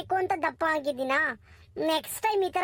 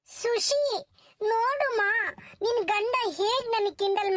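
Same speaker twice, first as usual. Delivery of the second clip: whiny complaint; 5 semitones higher than usual. Acted. Complaining to friend about teasing.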